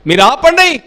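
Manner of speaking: loud, high scolding; hushing rebuke. Acted. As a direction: shut the crowd up sharply. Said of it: "అంట".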